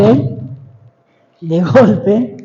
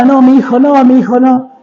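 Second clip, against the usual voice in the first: mother's mild retold yell, pitch slightly raised; imitated cry; 3 semitones higher than usual. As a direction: half-shout it like a pleading mother. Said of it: "Y gritaba". (grito materno imitado, contenido)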